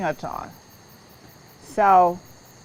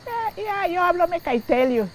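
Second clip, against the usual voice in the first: mock agreement; 11 semitones higher than usual. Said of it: "but if you accept it".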